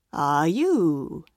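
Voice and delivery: low, sly wolf greeting; a hint of a big bad wolf. A narrator's reading. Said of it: "said the wolf".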